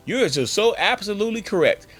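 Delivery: mock agreement, punched out high; over-eager agreement. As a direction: pile on the self-insults with too much energy. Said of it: "that's giving them some energy".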